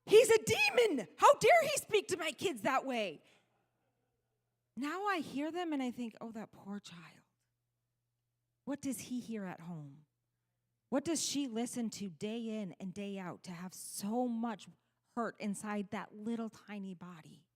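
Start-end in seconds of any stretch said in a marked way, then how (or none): 0.0-3.2 high-pitched, outraged mother's cry; mock the shocked mother in full outrage; indignant outrage. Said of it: "I would get really upset". 6.2-17.5 much softer, pitying murmur; hushed and tender, full of pity; compassionate. Retold or imitated. Her gentler thoughts about the child.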